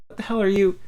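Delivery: loud, high, incredulous yell; doctor yelling at the caregiver, loud and high; outraged shout. Acted. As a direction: hurl the question in outrage.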